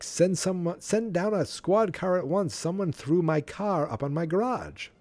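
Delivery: high-pitched mock complaint to police; upset caller. Man reporting his thrown car to police, upset caller.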